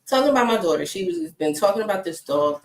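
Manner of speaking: normal talking voice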